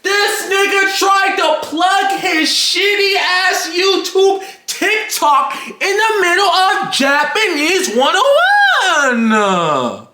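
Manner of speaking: high, drawn-out incredulous wail; outraged yelling. Real, not acted. Telling viewers of classmate's plug in Japanese class, outraged yell. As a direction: yell it slowly and high, stretching the words.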